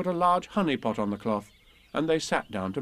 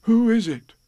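lightly voiced bear, high and louder; Pooh; 10 semitones higher, 7 dB louder than usual. A narrator's reading. Pooh calling to whoever is outside, higher and louder. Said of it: "So he said".

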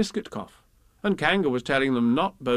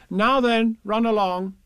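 high, brisk command; motherly Kanga; 10 semitones higher, 5 dB louder, slower than usual. A narrator's reading. Kanga sending Tigger and Roo out, high and firm. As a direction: shoo them off in a high voice.

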